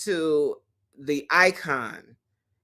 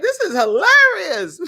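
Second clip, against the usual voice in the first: laughing; 6 dB louder than usual. Retold or imitated. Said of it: "I was like".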